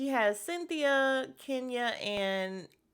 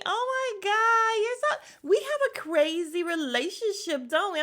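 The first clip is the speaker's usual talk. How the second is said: high-pitched, over-sweet fake gush; mock their fake friendliness, stretching the opening; phony gushing; 11 semitones higher, 4 dB louder than usual. Host mimicking two frenemies making up, phony gushing. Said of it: "then it goes to the phony".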